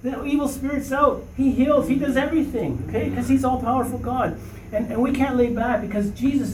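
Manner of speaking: high, rising with conviction; a preacher building to full fire; fervent preaching. Real, not acted. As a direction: lift the voice and proclaim it.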